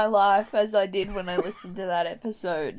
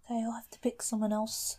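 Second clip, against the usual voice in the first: unvoiced whisper aside; 10 dB quieter than usual. Real, not acted. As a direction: whisper it to yourself.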